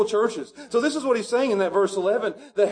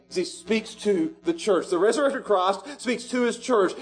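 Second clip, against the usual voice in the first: ordinary delivery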